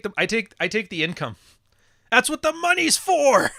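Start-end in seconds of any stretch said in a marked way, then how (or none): 2.1-3.5 much higher, slow, hammed-up ad man; "That's what the money's for" as a mock Don Draper; slow mocking boss voice, high and loud; Don Draper impression. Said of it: "Where's that Don Draper line?".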